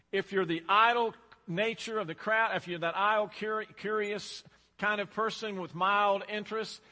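loud, emphatic sermon cadence; preaching. Real, not acted. Preacher warning the congregation, loud and emphatic. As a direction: press each point home, full voice.

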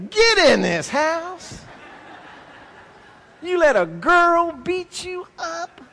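high, slow laughing mock scolding father; hammed-up dad scolding his beaten son. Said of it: "His daddy comes out".

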